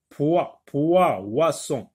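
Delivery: poisson split, "poi-" said twice loud then "oisson"; syllable by syllable. Shown as a demonstration. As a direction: break "poi-, poi-, oisson" into its sounds so "poi-" rings out. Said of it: "j'entends wa".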